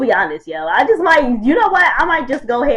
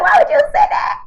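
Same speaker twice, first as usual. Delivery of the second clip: shrieking embarrassed; 4 dB louder than usual. Real, not acted. Embarrassed by old photo.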